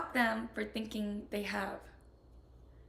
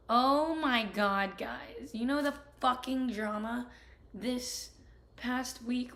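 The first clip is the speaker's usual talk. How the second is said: heated rant; 6 dB louder than usual. Real, not acted. Ranting about friends' claim.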